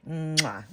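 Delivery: kiss sound. Real, not acted. Collector kisses her new doll with a smacking kiss.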